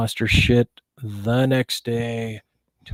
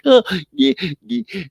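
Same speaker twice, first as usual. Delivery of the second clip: mock freaked-out; 10 semitones higher, 4 dB louder than usual. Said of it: "freaking out".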